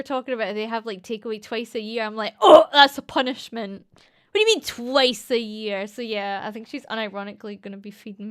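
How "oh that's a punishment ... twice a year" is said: hammed-up incredulity; mock outrage. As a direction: react as if appalled, slowing on the question. Aghast at takeaway only twice yearly.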